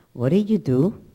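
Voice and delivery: loud, booming demand; confronting questions at full voice; God. Retold or imitated. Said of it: "then God says to Adam".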